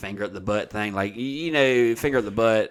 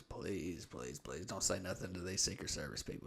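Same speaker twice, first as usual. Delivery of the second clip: much softer, begging wife's whisper; hushed pleading; 4 semitones lower, 17 dB quieter than usual. Retold or imitated. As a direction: plead quietly so the guards can't hear.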